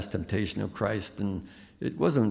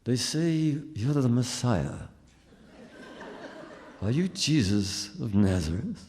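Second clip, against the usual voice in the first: King's English reading; 2 semitones higher than usual. Retold or imitated. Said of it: "I go".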